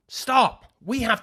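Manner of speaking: high sharp stop; exasperated shout. Real, not acted. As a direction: shut him down loudly. Cutting off co-host's joke.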